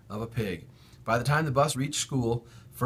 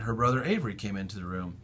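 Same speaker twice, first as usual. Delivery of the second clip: ordinary delivery, nothing marked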